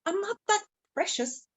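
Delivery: high-pitched, mildly annoyed lady; a bit annoyed. Acted. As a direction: protest in a high voice, slightly put out. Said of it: "started to get a bit annoyed".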